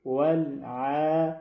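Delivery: ولع chanted slowly with a clear mid-throat ayn; slow, clean tajweed ولع. Shown as a demonstration. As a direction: draw out the throat sound in ولع without blockage. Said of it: "عین از وسط حلق خوب شفاف".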